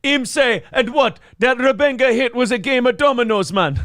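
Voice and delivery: high-pitched Jamaican patter; Jamaican accent. Acted. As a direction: put on a comic Jamaican accent. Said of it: "Him's going to Jamaica".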